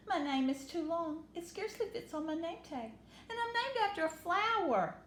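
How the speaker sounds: high, rising wail; whiny complaint. Acted. Upset Chrysanthemum complaining to her parents, high. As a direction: complain like a hurt little girl.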